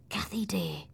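hushed, whispery and tender; soft, gentle near-whisper; quiet. Acted. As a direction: rouse a sleeper softly. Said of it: "I said quietly".

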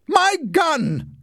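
high, loud cry of outrage; furious shout. A narrator's reading. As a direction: yell over the ruined gun. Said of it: "shouted Leslie".

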